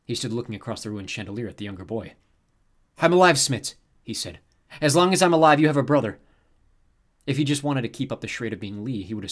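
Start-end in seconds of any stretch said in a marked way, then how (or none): 3.0-3.8 comforting. A narrator's reading. Comforting Smits after the accident. 4.8-6.2 comforting. A narrator's reading. Comforting Smits after the accident.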